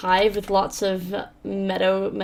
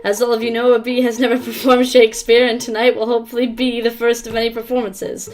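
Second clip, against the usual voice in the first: raised, louder host welcome; theatre announcer; 3 semitones higher, 5 dB louder than usual. Acted. Player voicing the bee theatre host's welcome, announcer style. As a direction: address the audience like a stage host.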